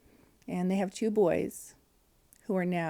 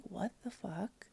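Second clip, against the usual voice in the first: slow, much softer shocked whisper of disbelief; stunned, hushed disbelief; 10 dB quieter, slower than usual. Retold or imitated. Telling husband of the death, slow hushed stunned disbelief. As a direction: breathe it out, too shocked to raise your voice. Reacting to sister-in-law's death.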